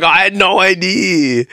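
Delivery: high, drawn-out protest; mock clueless surprise. Commenter finding out about the single, mock surprise. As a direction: stretch it out high, hammed-up.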